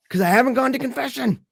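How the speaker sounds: high-pitched exasperated confession after a sigh; retold cornered admission. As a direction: admit it, cornered by a child. Cornered father answers his questioning son.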